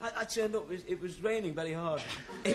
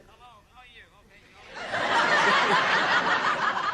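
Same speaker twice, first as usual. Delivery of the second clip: phone voice; 9 dB louder, slower than usual. Retold or imitated. Agent's voice on the phone.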